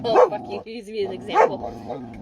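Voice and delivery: high, loud indignant dog voice; cheeky dog voice; voicing the dog. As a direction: voice the dog fed up at being used. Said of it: "The dog's like".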